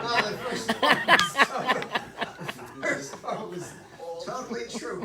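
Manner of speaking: laughing reply, loud at the start. Real, not acted. Teacher answering a class joke about the story, laughing. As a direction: talk through real laughter. Audience joke cracks the speaker up.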